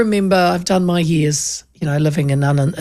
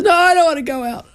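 higher, whinging refusal; whiny sick husband; 9 semitones higher than usual. Retold or imitated. Host imitates her sick husband refusing to go out. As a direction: moan like a reluctant sick man. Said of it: "He goes".

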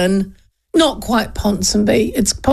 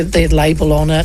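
ordinary talk, nothing marked

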